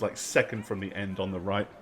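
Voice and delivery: usual speaking voice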